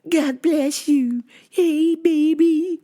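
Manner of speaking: high, slow, drawn-out coo; creepy stalker. Retold or imitated. Stalker greeting the worker, creepy slow coo.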